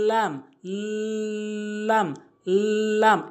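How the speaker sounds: "লাম" with a long held l; লাম demonstration. Shown as a demonstration. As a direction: hold the l in "লাম", tongue edge on upper gum. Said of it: "এভাবে আমরা উচ্চারণ করব". ("লাম", ল টেনে উপরের মাড়িতে)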